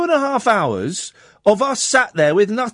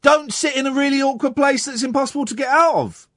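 high-pitched indignant questioning; worked-up exasperation; exasperated; 7 semitones higher than usual. Real, not acted. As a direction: challenge the caller in disbelief, pitch up. Host challenges the caller's doubts.

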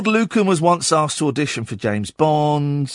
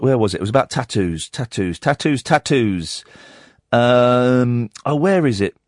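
ordinary talk, nothing marked

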